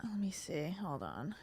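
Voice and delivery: unvoiced whisper to herself. Real, not acted. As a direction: whisper while scrolling. Whispering while searching.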